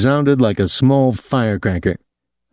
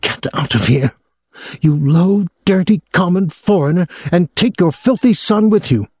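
rising higher and sharper with every insult, spitting the words; harsh venomous hiss; 9 semitones higher than usual. A narrator's reading. Schoolteacher spitting insults at a pupil's father, venomous rising hiss. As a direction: spit out contempt, climbing in pitch. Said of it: "She spoke in a harsh whisper".

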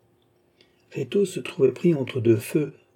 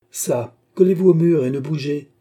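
raised, louder order; sharp command; 4 semitones higher, 7 dB louder than usual. A narrator's reading. Charny ordering the gazetteer to the wall, commanding. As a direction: order the captive to stay put.

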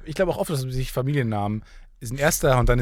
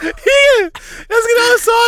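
much higher, louder, cracking with laughter; laughing high voice; 6 dB louder than usual. Pretend. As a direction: squeal it out through laughter.

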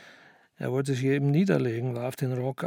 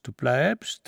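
plain reading voice

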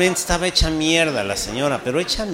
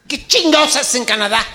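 loud, high outraged yell; exasperated shout; 10 semitones higher, 8 dB louder than usual. Acted. Comic exasperated shout at the grandson.

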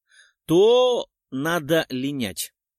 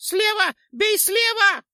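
very high, loud shriek; squealing Choplya; 6 dB louder, slower than usual. A narrator's reading. Choplya shrieks a warning to the witcher, very high. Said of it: "завизжала Чопля".

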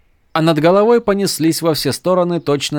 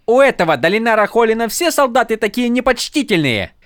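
high, offended complaint; indignant attendant; 11 semitones higher than usual. A narrator's reading.

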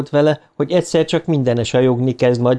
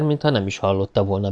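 ordinary delivery, nothing marked

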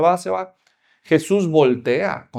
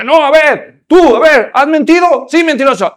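loud, higher accusing questions; stern pastor; 8 semitones higher, 7 dB louder than usual. Acted. Pastor interrogating the rich visitor, loud accusing. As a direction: grill him like an angry pastor.

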